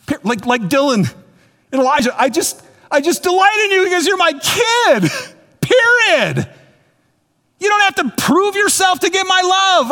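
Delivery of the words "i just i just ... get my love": high, emphatic outpouring of delight; delighted fatherly declaration. Acted. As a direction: insist on it like an overjoyed father, pitched way up. Acts out God's delighted fatherly reassurance.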